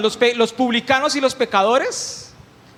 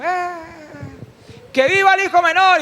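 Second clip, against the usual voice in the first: crowd cheering; 6 semitones higher than usual. Retold or imitated. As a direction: shout like a partying crowd. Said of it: "y la gente gritando".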